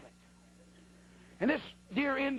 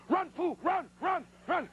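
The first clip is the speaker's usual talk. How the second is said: high, urgent repeated commands; panicky feet talking; 7 semitones higher, slower than usual. Retold or imitated. Feet to the frozen hunter, high mock panic. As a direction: pipe up high as his own feet begging to flee. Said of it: "my feet said".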